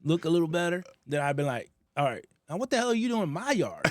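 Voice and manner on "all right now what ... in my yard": high, loud imagined yell; mock yell. Telling the neighbor off after a look, mock yell. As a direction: snap at her after a long look.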